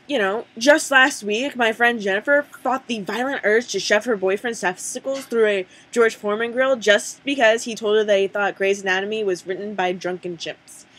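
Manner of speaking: usual speaking voice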